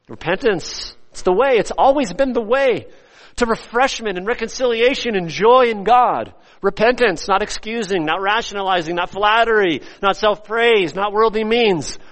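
high, loud, driving sermon voice; full-force preaching. Real, not acted. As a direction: hammer each point home to the congregation.

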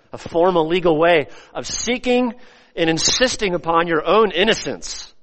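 ordinary talk

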